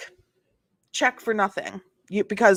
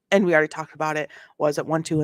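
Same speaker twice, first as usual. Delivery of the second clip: usual speaking voice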